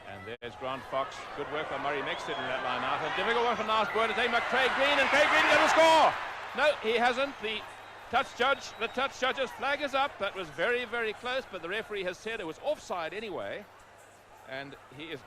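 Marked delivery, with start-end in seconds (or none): 3.1-6.1 rising from a fast excited call to a full high shout; commentator's voice climbs, louder and higher, into a yell of "gonna score". Real, not acted. Near try, live call. 6.6-13.6 still excited. Real, not acted. Try denied, still worked up.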